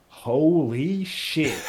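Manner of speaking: slow, stunned disbelief; Tyler's stunned exclamation. Acted. GM voices Tyler, stunned at the visitors: slow disbelief. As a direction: drag it out, floored. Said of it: "and he just goes".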